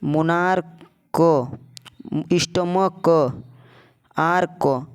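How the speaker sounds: slow word list, "मोनार्क", "स्टमक", "आर्क", each with a separate "क"; detached k endings. Shown as a demonstration. Teacher listing k-sound words, "मोनार्क", "स्टमक", "आर्क" slow with popped k. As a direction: release a clear k after "मोनार्क", "स्टमक", "आर्क". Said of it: "मोनार्क क का sound आ रहा है".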